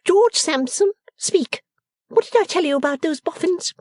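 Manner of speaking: high-pitched imperious demand; sepulchral warning. A narrator's reading. Miss Lavvy demanding George back her up, warning. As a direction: order him to answer, pitched high. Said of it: "sepulchral warning voice, founded on her mother's".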